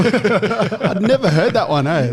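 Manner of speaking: high, slow laughing reply. Real, not acted. Reacting to a friend's story, laughing. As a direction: laugh out the words.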